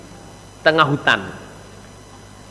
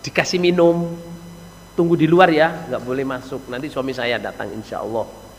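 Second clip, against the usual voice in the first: soft, courteous request, sinking low; acted gentle wife, trailing into a hush; polite wife; 4 semitones lower, 6 dB quieter than usual. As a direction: kindly ask the guest to wait outside. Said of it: "Bahasanya sopan, enak, Saudara".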